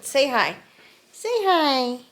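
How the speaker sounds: high, sing-song coaxing for the dog; talking sweetly to her dog; pet talk. Real, not acted. Coaxing her beagle on camera.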